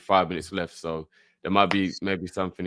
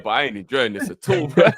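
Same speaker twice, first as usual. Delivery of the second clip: usual speaking voice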